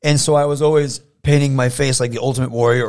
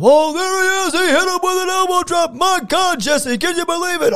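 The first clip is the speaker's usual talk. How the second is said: much higher, excited ringside commentator play-by-play; wrestling commentator voice; 5 dB louder than usual. Retold or imitated. Kid at home calling his own teddy bear match as the commentator. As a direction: call the action like an announcer at ringside. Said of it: "I would do all the promos".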